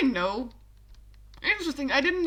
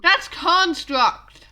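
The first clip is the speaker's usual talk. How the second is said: exasperated; 5 semitones higher, 8 dB louder, much slower than usual. Real, not acted. Exasperated DM correcting player.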